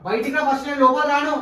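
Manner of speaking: loud high yell; angry shouting. Acted. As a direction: bellow the order. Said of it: "ఎందుకు అంత గట్టిగా అరుస్తున్నావు".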